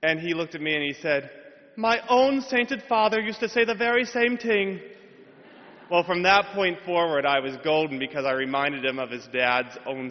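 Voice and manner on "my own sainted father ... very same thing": stage Irish brogue for the delighted professor; hammed-up Irish accent. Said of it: "Lucky Charms accent".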